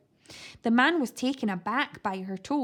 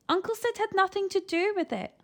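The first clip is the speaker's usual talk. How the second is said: put-on lying boy; 8 semitones higher than usual. A narrator's reading. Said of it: "lied Eric".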